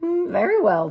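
high, bright hen voice; little red hen. Acted. The hen asking neighbours about seeds, in a high voice.

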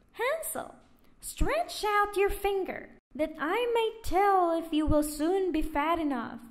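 high, loud witch call. A narrator's reading. Witch at the stable calling to Hansel, high and loud. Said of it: "and cried".

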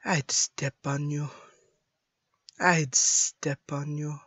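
understated cold character voice; lightly voiced assassin, no feeling; Widowmaker. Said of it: "like, Widowmaker has no feeling".

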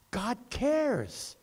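ordinary talk, nothing marked

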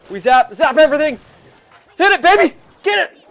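high, loud cheering at the screen; hyped shouting. Real, not acted. Gamer cheers his troops onto the tower, loud and high. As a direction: scream your troops on. Hyped during a big push.

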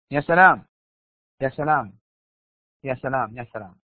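ordinary delivery

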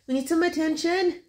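put-on high, cooing baby voice. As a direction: speak for the baby sweetly. Speaking for baby.

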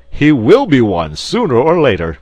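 loud, high insistent cry. A narrator's reading. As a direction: insist on it boisterously. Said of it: "cried Porthos".

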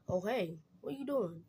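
ordinary talk, nothing marked

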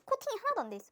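normal talking voice

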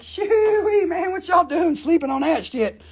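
high-pitched mock hollering; imitated gloating customer. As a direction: whoop it up, mocking the slowpokes. Acts out Tina jumping in ahead.

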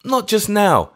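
put-on high, loud, over-eager old vicar; eager vicar turning down wine, high; hysterically eager refusal. A narrator's reading. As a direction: rush to refuse, overeager. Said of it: "with that hysterical eagerness".

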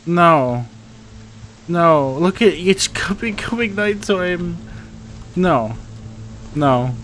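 high, loud, desperate protests; panicked no. Real, not acted. Stuck as night falls, high panicked cries.

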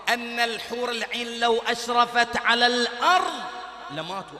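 slow, loud, soaring description; full-force preaching. Real, not acted. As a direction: lift the crowd with wonder. تصاعد خطابي. (وعظ متحمس بصوت عالٍ)

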